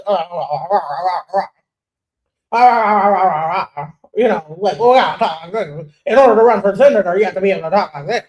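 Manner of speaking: halting, stammering uh-uh-uh parody of formal speech; mocking stiff politician talk. Mocking critics who want stiff senator talk, stammering parody. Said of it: "because she's not talking like this".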